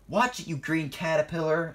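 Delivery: offended warning, called out; shouted. A narrator's reading. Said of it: "shouted Ernest".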